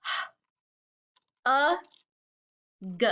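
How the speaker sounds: "H, a, g" one sound at a time; breathy h, held "a" as uh, short g. Shown as a demonstration. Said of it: "one more time".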